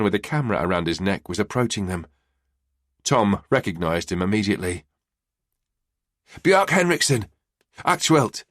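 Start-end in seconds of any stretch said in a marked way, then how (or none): 6.4-8.4 panting after a run; breathless, hurried; out of breath. Acted. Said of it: "the out-of-breath journalist said".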